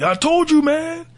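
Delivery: [name]. Retold or imitated. Imitating a rapper saying I-told-you-so, bad high-pitched impression. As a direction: badly imitate a rapper in a high, strained cry. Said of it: "That was a bad impression".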